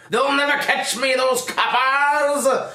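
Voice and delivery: high, loud gloating villain; mad scientist. Acted. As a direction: cackle like a cartoon crook. Plant killer villain bragging.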